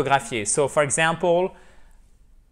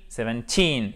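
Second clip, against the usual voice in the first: put-on seventeen, lower, falling, stress on "teen"; 4 semitones lower than usual. Shown as a demonstration. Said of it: "c'est la même chose".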